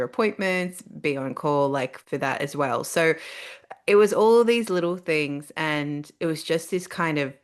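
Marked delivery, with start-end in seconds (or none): none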